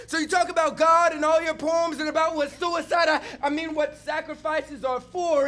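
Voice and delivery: intense spoken word performance, rising and falling; slam poetry. Acted. Poet performing on stage for the audience, impassioned spoken word. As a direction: preach the poem to the crowd like a sermon.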